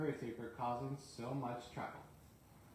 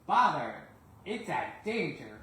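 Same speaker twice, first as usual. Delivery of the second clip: annoyed exclamation; 3 semitones higher, 8 dB louder than usual. Acted. Percy stopped by a signal.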